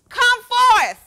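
high, booming command; loud cry. Acted. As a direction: summon him at full voice. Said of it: "he cried with a loud voice".

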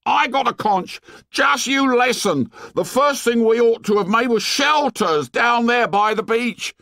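put-on high-pitched boy losing his temper; angry tirade. A narrator's reading. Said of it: "Piggy lost his temper".

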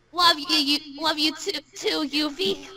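ordinary talk, nothing marked

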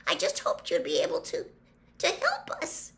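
high, faltering, panicky plea; horror-struck squeak. A narrator's reading. Hermione tries to take back her mistake, high horrified squeak. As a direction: squeak out a halting apology. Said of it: "in a horror-struck squeak".

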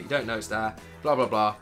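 ordinary delivery

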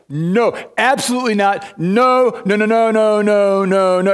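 Paul refusing flat out, again and again; emphatic no. Retold or imitated. Paul rejecting going on in sin, emphatic loud no. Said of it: "Paul's like".

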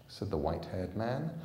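ordinary delivery

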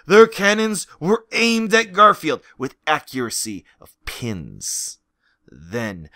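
usual speaking voice

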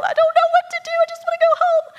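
very high, loud mock wail; freaking-out baby bird. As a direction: squeal it in hammed-up panic. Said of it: "he's freaking out".

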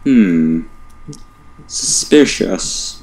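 mock suspicious.

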